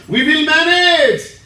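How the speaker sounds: loud, slow defiant voice; mock defiance. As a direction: mimic the stubborn crowd.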